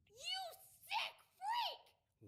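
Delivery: very high, outraged cry; horrified fury. Acted. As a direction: burst out at the killer, voice shooting up. Learns who killed her father.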